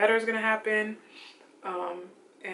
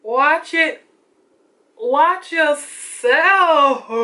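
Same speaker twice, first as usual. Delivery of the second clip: very slow, louder and higher, stretched out; sassy brag; 6 semitones higher, 8 dB louder, much slower than usual. Pretend. Vlogger to camera, slow sassy showing off her glow. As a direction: drag each word out like a diva.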